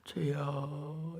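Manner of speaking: very soft, slow, drawn-out moan; exhausted whisper-like sigh. Retold or imitated. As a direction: let it fade out spent. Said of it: "소리를 더 이상 못 질러". (지쳐서 길게 새어 나오는 탄식)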